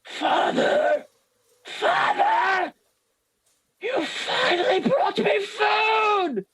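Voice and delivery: very high, strangled shrieking voice; Doro. Acted. Monstrous child greets father bringing food, high screech. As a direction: screech it out, dragging the last words. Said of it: "you hear Doro say".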